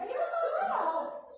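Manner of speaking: put-on high, scoffing mother to children; mocking rebellious mother. Mother stirring children against father, high scoffing. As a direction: sneer it at the kids like Korah's wife. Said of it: "Olha aqui, meus filhos".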